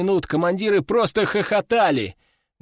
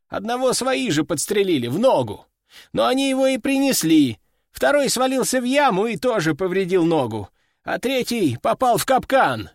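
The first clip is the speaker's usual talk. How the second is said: higher-pitched subordinate reporting losses; higher, dutiful soldier's voice; sergeant major reporting; 6 semitones higher than usual. A narrator's reading. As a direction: report to the general in a raised pitch.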